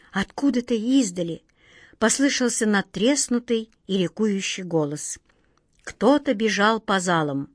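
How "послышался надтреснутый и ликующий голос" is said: usual speaking voice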